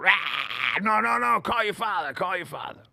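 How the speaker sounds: high-pitched mock panicked pleading; retold freak-out. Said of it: "I just start fucking freaking out".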